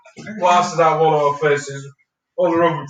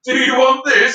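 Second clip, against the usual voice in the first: mimicking; 9 semitones higher, 4 dB louder, slower than usual. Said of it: "the way you looked at me after you'd said it".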